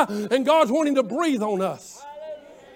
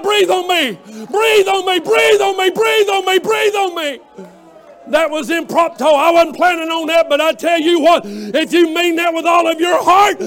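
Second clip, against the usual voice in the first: full-force preaching; 3 semitones higher, 6 dB louder than usual. Real, not acted. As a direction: drive the congregation to their feet. Preacher leads rousing altar call.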